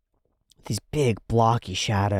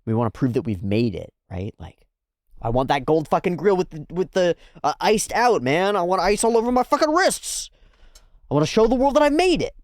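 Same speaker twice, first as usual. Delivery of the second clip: rising higher and louder, bragging; building hammed-up swagger; cocky Joe; 6 semitones higher, 4 dB louder than usual. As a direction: brag harder and higher to the end. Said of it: "And he's like".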